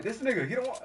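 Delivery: high, loud disbelief; mock indignation. Swimmer about the unhelpful lifeguard, high mock outrage. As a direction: complain in acted outrage.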